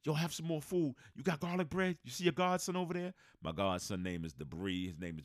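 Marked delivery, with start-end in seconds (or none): 0.0-3.1 lightly voiced friendly host, pitched higher; a higher, breezy imitation of the friend piling on food; friend playing host. Friend urging the guest to eat, in a mock hearty high voice. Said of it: "He goes".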